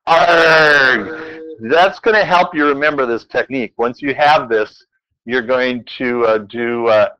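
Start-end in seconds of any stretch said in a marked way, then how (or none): 0.1-1.4 loud, higher "Arg" with a long rolling pirate R; pirate R-heavy arg. Shown as a demonstration. Teacher asking for more R, "Arg" shouted with drawn-out R. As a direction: stretch the R in "Arg" into a pirate roar. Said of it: "Now put more R in it".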